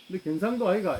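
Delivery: accusing bystander in thick Gyeongsang dialect; 경상도 사투리로 따지듯; Gyeongsang dialect accusation. Acted. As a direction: jab at him in Gyeongsang dialect, rising. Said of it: "서울 가면은 우리 말 못 속입니다".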